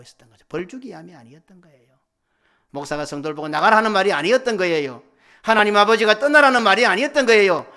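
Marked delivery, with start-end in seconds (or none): 2.7-7.7 loud, high, building to a shout; full-force preaching. Real, not acted. Preacher correcting the congregation's misunderstanding, impassioned shouting. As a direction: drive each denial home at full volume.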